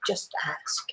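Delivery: retold advice in a hushed near-whisper. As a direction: drop to a very soft, breath-only whisper. Retold quiet advice from old healer.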